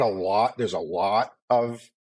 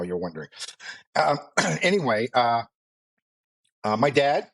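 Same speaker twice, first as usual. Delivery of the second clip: usual speaking voice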